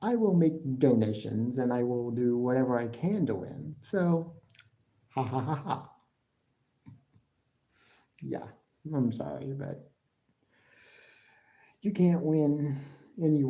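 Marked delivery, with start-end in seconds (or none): none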